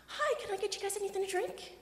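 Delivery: high bright server voice; chirpy customer service voice. Shown as a demonstration. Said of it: "you're about to hear my customer service voice".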